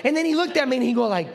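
ordinary talk, nothing marked

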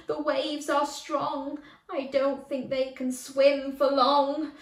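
plain reading voice

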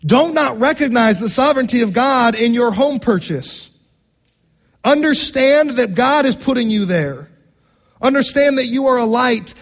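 normal talking voice

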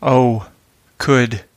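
"oh," "could," both stressed evenly; demonstrating a spondee on "oh," "could,". Shown as a demonstration. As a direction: hit "oh" and "could" equally hard. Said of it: "two stressed syllables together".

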